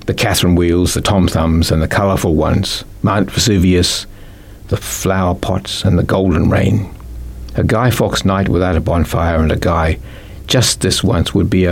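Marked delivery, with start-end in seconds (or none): none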